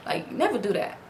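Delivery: ordinary delivery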